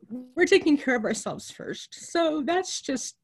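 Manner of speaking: fighting back tears for the dying patients; getting emotional. Real, not acted. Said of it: "I'm getting emotional".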